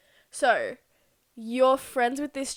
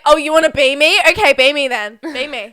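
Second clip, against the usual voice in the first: mocking; 4 semitones higher, 13 dB louder than usual. Mock reply to the friend.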